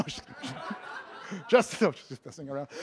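ordinary talk

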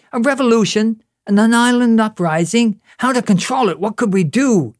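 high-pitched mock panic in short bursts; theatrical alarm, voice pitched well up; agonized alarm. Ottawa fretting over an island uprising, agonized mock alarm. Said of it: "this was quite agonizing".

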